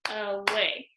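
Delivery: "away" in two short beats; clapped out. Shown as a demonstration. Teacher clapping syllables after sounding it out, "away" in short beats. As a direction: say "a-way" briefly, one beat per syllable. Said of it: "I'm gonna do my clapping".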